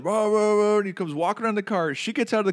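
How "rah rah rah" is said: high, wordless angry ranting; mock yelling. Irate driver coming round the car, mock yelling. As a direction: imitate an irate man's tirade in raised pitch. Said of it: "He's yelling".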